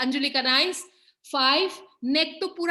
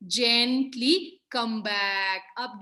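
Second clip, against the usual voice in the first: slow cue; 3 semitones lower, much slower than usual. Real, not acted. Yoga cue, drawn out.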